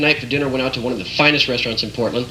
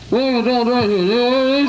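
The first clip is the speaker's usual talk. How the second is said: high confused clerk; 9 semitones higher than usual. Retold or imitated.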